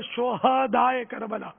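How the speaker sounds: slow شہدائے with an open "ha", sho-ha-daa-e; original pronunciation. Shown as a demonstration. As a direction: give شہدائے a short a after the h. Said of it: "اصل لفظ ہے".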